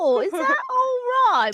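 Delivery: mock British accent. Teasing someone keen on British accents, hammed-up British accent. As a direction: play up a silly British voice. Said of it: "My kink is British accents".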